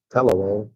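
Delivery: Palawan said PAL-a-won; wrong take, PAL-a-won for Palawan; mispronounced Palawan. Shown as a demonstration. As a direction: open Palawan with the a of cat. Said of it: "Or they say".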